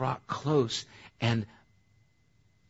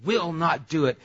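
raised, emphatic promise to God; sincere vow; 5 semitones higher, 5 dB louder than usual. Acted. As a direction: promise it earnestly, pitched up and forceful. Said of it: "And we're sincere".

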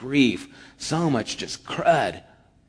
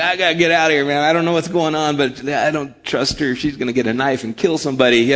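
loud, higher, rushing panic; panicked teen; 4 semitones higher, 7 dB louder, faster than usual. Retold or imitated. Telling Dave he fears the mom, loud panicky rush. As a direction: get out of there fast, half joking. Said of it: "but I was like".

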